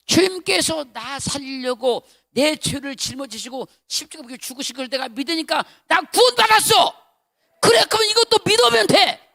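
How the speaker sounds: loud, high preacher's cry; fervent preaching. Acted. Pastor retelling his revelation to church, loud fervent preaching. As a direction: shout the revelation with fervor.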